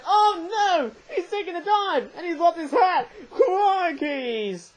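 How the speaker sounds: high-pitched, hammed-up cry of dismay; mock alarm. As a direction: play up a tiny disaster as a catastrophe. Mock disaster as the figure falls.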